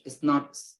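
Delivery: ordinary talk, nothing marked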